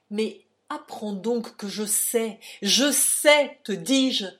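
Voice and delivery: loud, mounting declaration; forceful, angry revelation; furious insistence. A narrator's reading. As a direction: drive it home, swelling to a shout. Lovers' quarrel, his furious insistence.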